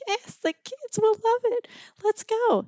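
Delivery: very high, squeaky put-on voice; eager agreement. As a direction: chirp it out in a mock-eager falsetto. Acted eager agreement to ride.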